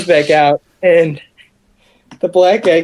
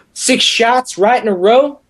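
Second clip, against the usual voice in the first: bragging partier; 6 semitones higher than usual. Retold or imitated. Imitating drunk guy bragging about night.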